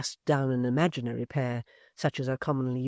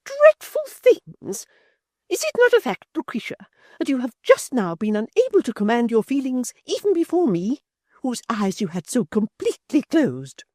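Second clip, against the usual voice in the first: scornful repeat; 11 semitones higher, 10 dB louder than usual. A narrator's reading. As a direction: shriek the words back in disbelief. Chick's indignant speech.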